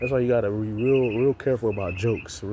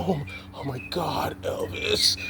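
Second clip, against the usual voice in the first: mock fawning fan; 3 semitones higher, slower than usual. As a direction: swoon like a starstruck fan. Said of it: "if they had somebody who was a great musician".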